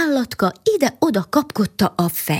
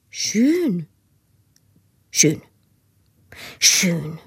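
tasting the word; 2 semitones lower, much slower than usual. A narrator's reading. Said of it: "ízlelgette a szót az állatka".

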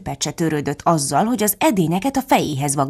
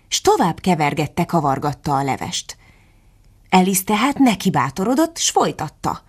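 ordinary delivery, nothing marked

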